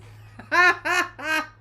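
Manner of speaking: loud high burst of laughter; big ringing laugh, well up in pitch. Real, not acted. Amused by chat's sports comment.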